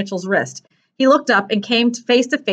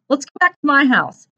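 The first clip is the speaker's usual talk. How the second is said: put-on higher, eager young voice; high, slightly anxious suggestion; earnest boy character; 5 semitones higher than usual. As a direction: propose it as a hopeful boy. Boy character suggests his house.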